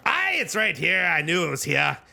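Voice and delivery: a hint of a pleased Scottish shopkeeper; Scottish accent. Dwarf shopkeeper finds the cloak, light Scottish accent. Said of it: "the classic Scottish accent".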